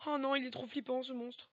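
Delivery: spooked by the monster; scared. Real, not acted. Monster close behind.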